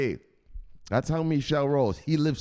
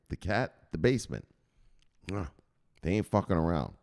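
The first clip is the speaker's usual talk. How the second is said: ordinary delivery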